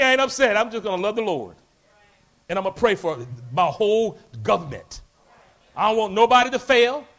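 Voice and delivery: normal talking voice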